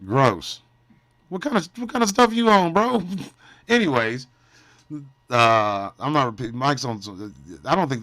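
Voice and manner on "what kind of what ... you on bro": mock incredulous. Mocking chat's claim.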